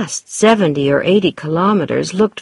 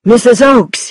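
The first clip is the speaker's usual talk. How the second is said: a raised, urgent narrated shout; excited shout; 6 semitones higher, 6 dB louder than usual. A narrator's reading. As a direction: call out to her, higher and louder. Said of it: "he shouted".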